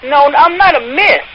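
usual speaking voice

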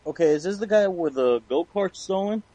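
normal talking voice

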